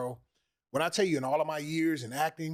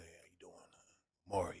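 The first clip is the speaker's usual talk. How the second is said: low hushed greeting; 9 semitones lower, 11 dB quieter than usual. Retold or imitated. Imitating Omari's low greeting.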